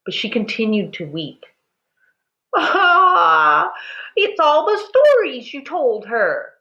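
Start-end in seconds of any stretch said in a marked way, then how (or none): 2.5-6.5 high, loud sobbing cry. A narrator's reading. Mother blaming her husband's stories, loud high sobbing. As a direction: sob out the blame. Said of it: "Ma sobbed".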